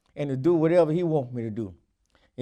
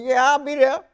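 eager yes; 9 semitones higher, 5 dB louder than usual. Retold or imitated. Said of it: "I said".

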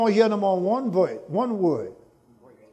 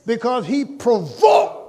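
slow, loud and high proclamation; preaching shout; 5 semitones higher, 5 dB louder, slower than usual. Real, not acted. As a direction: drag it out and hammer it home.

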